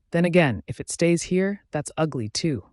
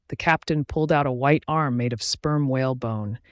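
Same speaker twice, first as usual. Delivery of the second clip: ordinary delivery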